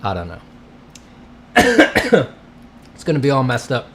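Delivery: ordinary talk, nothing marked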